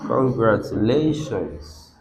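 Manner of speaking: congratu-LA-tions, LA stretched, slow and low; stress on fourth syllable. Shown as a demonstration. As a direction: softly lean on LA in "congratulations". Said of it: "we have it that the stress".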